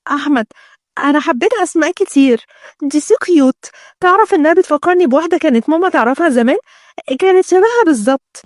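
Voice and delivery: high, cutesy fiancée voice; spoilt bride. Acted. Bride telling Ahmed she likes Asmaa, cutesy and high. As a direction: gush like a pampered bride.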